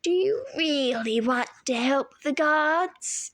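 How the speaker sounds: put-on slow, high luring old woman's voice; sweet monster. A narrator's reading. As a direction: coax him to look up. Medusa luring Percy.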